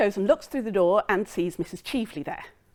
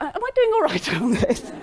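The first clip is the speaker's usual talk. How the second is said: laughing aside; 12 semitones higher than usual. Real, not acted. Speaker laughs at own retelling.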